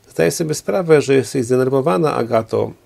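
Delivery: plain reading voice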